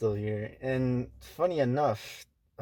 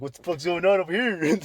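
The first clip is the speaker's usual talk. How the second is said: higher, louder nosy call; mock nosy call-out; 7 semitones higher, 6 dB louder than usual. Butting into the customer's argument, loud and high. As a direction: butt in loudly, playing nosy.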